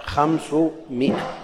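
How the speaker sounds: "خمسمائة" said slowly as khamsu-mi'a; slow model reading of "خمسمائة" as khamsu-mi'a; correct pronunciation. Shown as a demonstration. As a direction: sound out "خمسمائة" with short i, no long aa. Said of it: "تنطق هكذا".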